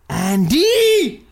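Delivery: stage psychic's big drawn-out call of Andy. Pretend. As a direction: belt the name like a stage medium reaching the spirits. Fake psychic calling out name.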